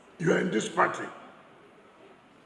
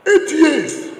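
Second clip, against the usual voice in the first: shouted out, higher and louder; emphatic shout; 8 semitones higher, 7 dB louder than usual. Real, not acted. Rally speaker to crowd about rival's record, a loud shout. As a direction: hurl it at the crowd.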